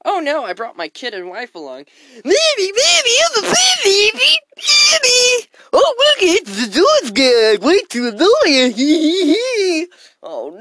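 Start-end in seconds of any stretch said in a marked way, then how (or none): 2.1-5.5 put-on very high, loud whiny child voice; bratty kid. Kid whining at his dad in Costco. 5.7-9.9 put-on high, loud, slow giggling child; bratty kid. Kid spotting the Doors guy, shrieking.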